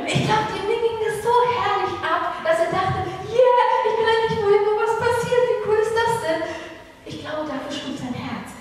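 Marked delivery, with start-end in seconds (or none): 0.1-6.4 much higher and louder, bursting with joy; young David cheering that he can finally go to war; thrilled. Acted. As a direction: let the excitement burst out, cheering.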